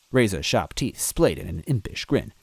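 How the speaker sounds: plain reading voice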